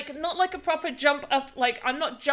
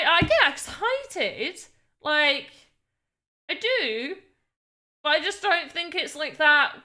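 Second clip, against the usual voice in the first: normal talking voice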